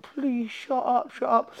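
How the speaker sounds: slow, high, pleading shut up; embarrassed plea. Retold or imitated. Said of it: "I'm like".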